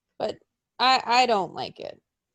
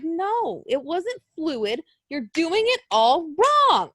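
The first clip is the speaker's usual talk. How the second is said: slow, higher-pitched objection; dismissive retort; 6 semitones higher, slower than usual. Retold or imitated. Telling the fans the move was done wrong, slowly and a bit higher. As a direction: slowly spell out the fault. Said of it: "I'm like".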